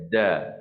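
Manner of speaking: "Da" with a thin, open d, tongue not lifted; huruf dal nipis. Shown as a demonstration. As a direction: say "Da" light and thin. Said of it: "Contoh kita sebutlah huruf dal". (sebutan "Da" nipis, lidah tidak naik)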